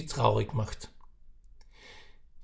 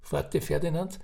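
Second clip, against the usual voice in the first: plain reading voice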